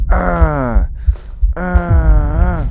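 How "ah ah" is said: short high yelps of fright; two sharp frightened yelps; startled cries. Real, not acted. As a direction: yelp in real fright.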